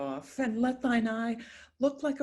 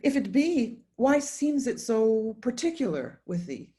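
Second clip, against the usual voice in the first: much higher and louder, pushing the question; exasperated challenge; 5 semitones higher, 6 dB louder than usual. Acted. Mother challenging son's grief, raised exasperated voice. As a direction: demand an answer, voice raised high.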